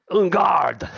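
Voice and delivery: shouted French en garde, high; french chef. Acted. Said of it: "And he".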